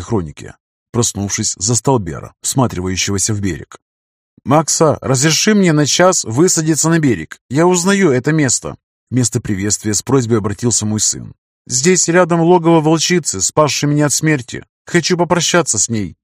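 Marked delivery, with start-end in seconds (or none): 4.4-8.8 put-on eager higher young man's plea; young son voice. A narrator's reading. Son Ber pleads to land. 11.7-16.2 put-on eager higher young man's plea; young son voice. A narrator's reading. Son Ber explains the wolf.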